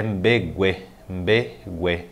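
"mbegue" slowly, split into two beats; broken into syllables. Shown as a demonstration. Teacher introducing a Guarani word, syllable by syllable. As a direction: say "mbe... gue" with a gap between syllables.

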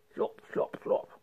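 whispered slurping vacuum noises. Acted. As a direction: let the machine gulp it down softly. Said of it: "sagde støvsugeren".

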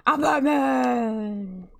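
slow, drawn-out superhero growl; Batman. Pretend. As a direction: stretch it out as the caped hero. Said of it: "If you guys get that reference".

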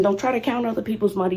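ordinary talk, nothing marked

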